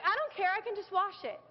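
put-on high, carefree kid voice brushing it off; high mimicked child shrugging off a scolding; sassy little girl. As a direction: play the unbothered four-year-old talking back to Dad. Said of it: "she's like".

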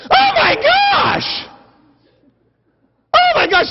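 very high, drawn-out shriek of excitement; mock freaking out. Lady spots a magazine in the cafe, mock freak-out. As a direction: squeal it loudly, hammed-up. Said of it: "she's just freaking out".

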